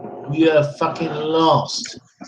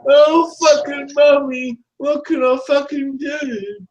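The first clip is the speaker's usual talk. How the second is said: high, whiny parody of a sobbing rival; mock crybaby; 10 semitones higher than usual. Man mimicking rival crying to mummy, mocking whine. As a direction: wail it high like a helpless child, mocking. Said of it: "crying and the crinkle crankles".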